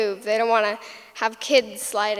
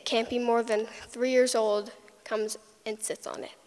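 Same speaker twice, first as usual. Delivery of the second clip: ordinary talk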